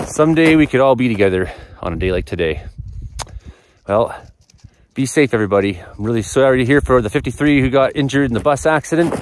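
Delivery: normal talking voice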